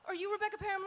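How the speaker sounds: put-on high, stammering teen; stammering fan. Teen in checkout line nervously asking a youtuber, stammering high. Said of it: "he's like".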